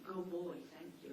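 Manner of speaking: soft, low, unbothered praise; softly thanking the barking dog; calm thank you. Shown as a demonstration. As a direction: praise the dog quietly and calmly. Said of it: "I just got up and I went".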